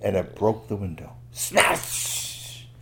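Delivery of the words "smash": high, hissing crash word with a long tail; drawn-out noisy "smash"; smash effect. Acted. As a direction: let the end sizzle like falling glass. Storyteller imitates breaking window.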